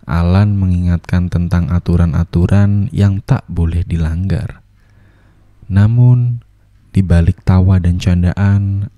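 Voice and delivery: storyteller voice hinting at doom; ominous narration. A narrator's reading. Narrator foreshadowing the lie, ominous storytelling. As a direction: narrate the coming disaster gravely.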